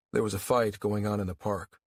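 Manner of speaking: usual speaking voice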